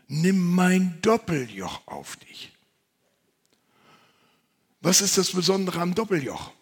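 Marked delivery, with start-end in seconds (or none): 0.1-2.5 slow, louder proclamation; solemn quote. Acted. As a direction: say it slowly, like a promise. Quoting Jesus slowly, louder.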